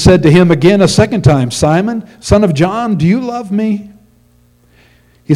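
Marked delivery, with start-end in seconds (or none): 1.5-3.9 higher, lightly voiced tender question from Jesus; a hint of Jesus pleading with his disciple; Jesus questioning Peter. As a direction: ask it gently, voice lifted, as Jesus to Peter. Jesus asks Peter second time.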